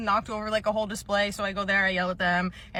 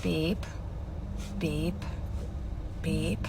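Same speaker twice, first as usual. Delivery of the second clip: short, soft, lower mouth beep like a barcode scanner; 5 semitones lower, 7 dB quieter, much slower than usual. Acted. Clerk scanning a customer's items, mouth-made scanner beep. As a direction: imitate the register scanning items.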